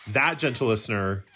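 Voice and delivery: ordinary talk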